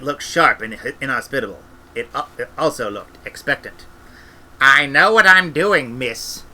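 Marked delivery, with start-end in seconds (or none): 4.6-6.4 loud, slow curt snap; snapped. Acted. Granny snapping at Esk on the broom, loud and slow. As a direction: bite the words off at her. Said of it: "snapped Granny".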